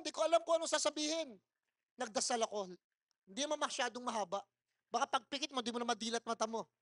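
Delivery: normal talking voice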